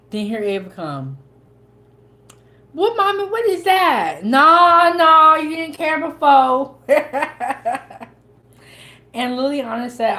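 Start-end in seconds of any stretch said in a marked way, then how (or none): 2.7-4.2 put-on child voice. Daughter voiced asking mommy. 4.2-6.8 teasing. Retold or imitated. Mom teasing daughter, laughing. 6.8-8.0 laughing. Real, not acted. Laughs after teasing.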